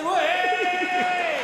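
high, loud, long-held whoop; jeering cheer. Acted. Cheering on a fallen old person, jeering whoop. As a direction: shout a drawn-out mock cheer. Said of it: "a wee wanker say".

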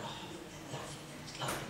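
hushed, lovely lovely; soft mock admiration. Diner watching the loving couple, hushed mock coo. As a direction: coo it under your breath.

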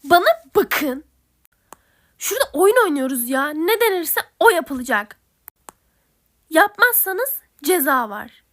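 loud, firm telling-off, slowing on the threat; angry scolding. Acted. Game host yells the rules at bickering players, loud and firm. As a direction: shout the rules at them.

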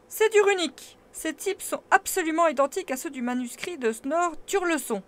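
high, loud excited exclamation; exclaiming. A narrator's reading. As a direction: announce the discovery eagerly. Professor exclaims discovery.

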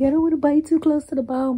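high, loud mocking imitation; Nicole. Quoting a friend's excuse about eating wings, high mocking voice. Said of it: "Nicole be like".

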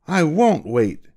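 high, slow defiant cry; talking back. Acted. Duke defying his king, high and slow. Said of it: "the Grand Duke talked back to the king".

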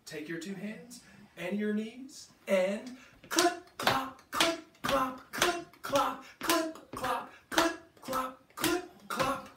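high clip-clop chant. Shown as a demonstration. Librarian leading kids to clap along, high chant. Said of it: "Can you do that with me?".

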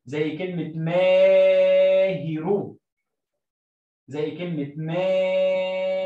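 ماهر with the ما drawn out very long and slow; long vowel stretch in ماهر. Shown as a demonstration. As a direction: hold the ما in ماهر and مانع. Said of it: "بطول فيها ما".

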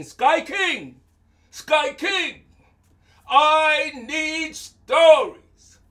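high, loud calling. Acted. Anansi calling the Sky King for stories, loud and high. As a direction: call up to the king, slow and insistent.

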